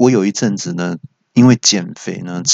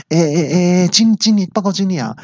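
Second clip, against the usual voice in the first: flustered stammer; 8 semitones higher than usual. Retold or imitated. Caught insulting the manager, stammers high in panic. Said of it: "我就赶快说".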